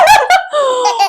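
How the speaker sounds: shrieking laughter; laughing. Real, not acted. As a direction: laugh loudly after a scare. Laughing after her scream.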